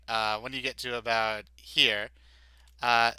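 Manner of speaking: ordinary talk, nothing marked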